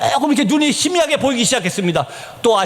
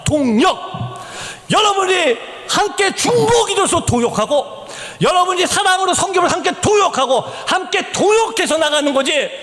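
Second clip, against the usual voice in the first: higher, emphatic appeal with drawn-out key words; impassioned preaching; 7 semitones higher than usual. Real, not acted. Preacher calling congregation to share in missions, impassioned. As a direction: preach it hard, stressing partnership.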